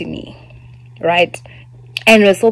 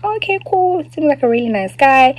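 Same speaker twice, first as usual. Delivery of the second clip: breezy inner thought; 7 semitones higher than usual. Retold or imitated. Her own thought about the guy.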